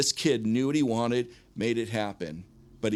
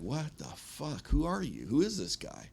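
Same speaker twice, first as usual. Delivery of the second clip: imitating stunned disbelief, high and hushed; drawn-out first words, then baffled questions; mind blown; 4 semitones higher, 5 dB quieter, slower than usual. Venue owner whose mind was blown, stunned. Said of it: "I blew his mind".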